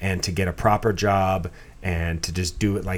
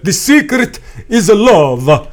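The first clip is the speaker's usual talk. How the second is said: high, loud, slow, hammed-up old-timer; mock old couple; 11 semitones higher, 11 dB louder, much slower than usual. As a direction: draw it out like a sappy old grandpa. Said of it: "the old couple's always like".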